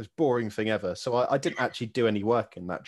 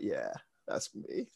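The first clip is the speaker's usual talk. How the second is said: much lower, slow, laid-back boast; cool rock-star reply; 10 semitones lower, 9 dB quieter, slower than usual. Retold or imitated. Knight answering awed families, lower slow cool boast. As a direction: play it like a shrug of a rock star. Answering kids who recognise him.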